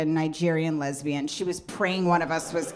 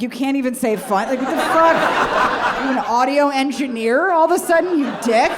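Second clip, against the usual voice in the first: indignant outburst; 7 semitones higher, 7 dB louder than usual. Retold or imitated. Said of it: "I'm like".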